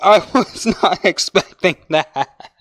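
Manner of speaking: talking through laughter, slow; words broken by laughs; laughing. Real, not acted. Still laughing after scare.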